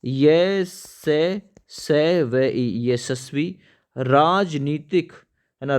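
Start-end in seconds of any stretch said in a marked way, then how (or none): 0.0-2.9 drawn-out letters for dictation; slow spelling out. Shown as a demonstration. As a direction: spell ये से, से व ई very slowly. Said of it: "यशस्वी भव तो important". (बहुत धीरे अक्षर गिनाते हुए)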